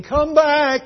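high, loud retold cry; crowd calling out. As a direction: call it out like the remembered crowd. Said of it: "a sea of bronze-skinned faces saying".

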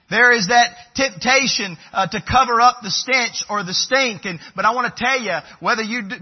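high, loud fervent preaching; a preacher declaring nothing can be earned, full force. Real, not acted. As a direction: pour it out to the congregation.

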